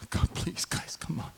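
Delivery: breathy whispered pleading to the crowd; whispered plea. Real, not acted. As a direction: beg them under your breath. Pleading with silent congregation.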